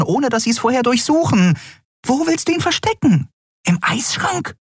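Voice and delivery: very high, incredulous squeak; panicky, squeaky arguing; flustered Nelen. A narrator's reading. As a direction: stutter into a frantic high-pitched challenge.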